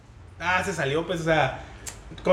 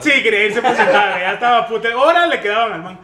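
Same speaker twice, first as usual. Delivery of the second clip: laughing; 4 semitones higher, 8 dB louder than usual. Real, not acted. Laughing about old client.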